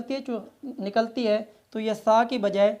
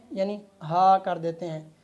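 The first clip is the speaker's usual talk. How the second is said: usual speaking voice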